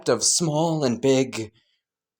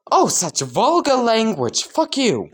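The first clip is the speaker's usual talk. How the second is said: mock-offended retort; 9 semitones higher than usual. Acted quarrel over swearing.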